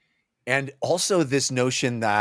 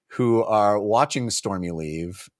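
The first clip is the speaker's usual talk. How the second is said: usual speaking voice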